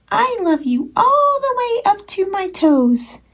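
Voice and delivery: high, upside-down little bunny; giggly tiny hare reaching up; excited little hare. Acted.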